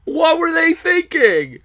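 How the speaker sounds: high, slow, loud catchphrase wail; mock wailing cry.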